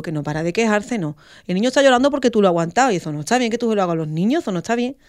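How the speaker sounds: angry scolding. Retold or imitated. Mother furiously scolding the nurse in front of the doctor. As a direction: tell her off, fast and indignant. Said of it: "el médico me vio muy, muy enfadada".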